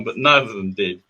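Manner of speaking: usual speaking voice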